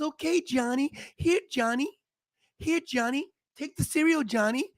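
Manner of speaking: lightly voiced, soft indulgent mom; pushover mom. Lax mom soothing her whining son, mildly voiced. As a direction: give in sweetly to the child. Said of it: "the mom goes".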